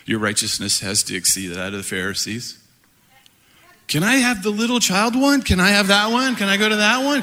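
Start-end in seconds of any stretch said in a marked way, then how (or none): 3.9-7.2 put-on high, pleading kid voice; mock childish pleading; eager child asking. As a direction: beg like an eager little kid. Mimicking eager disciples asking.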